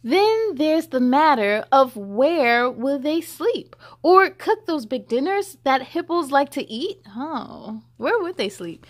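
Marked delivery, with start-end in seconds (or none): 0.0-7.0 put-on lively read-aloud to children; story reading. A narrator's reading. Reading the hippo book to children, lively storybook voice. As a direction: read it bright and sing-song for kids.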